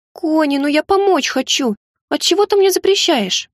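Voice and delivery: высоко, жалобно, медленно; упрашивать друга, почти плача; умоляюще. Acted. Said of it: "Лара взмолилась". (умоляющий детский голос)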